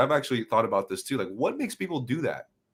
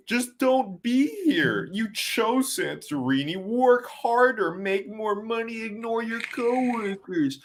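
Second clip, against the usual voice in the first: much higher, slow sneering advice; mock advice; 11 semitones higher, slower than usual. Pierre mocking critics' advice to the waiter, much higher and slow. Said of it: "what if I just told them".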